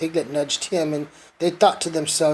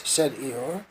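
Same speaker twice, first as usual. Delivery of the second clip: usual speaking voice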